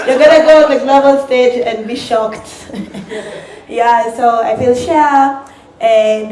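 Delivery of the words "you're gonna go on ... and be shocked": loud, laughing mock lecture. Bartender boasting to Kenyans, loud mock lecture. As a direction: play the know-it-all host, loud and laughing.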